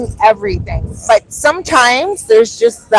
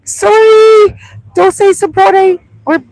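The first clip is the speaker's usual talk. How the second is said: much higher, louder apology; 10 semitones higher, 7 dB louder, slower than usual. Real, not acted. Vlogger apologizing to the restaurant, high loud. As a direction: call it out to the restaurant.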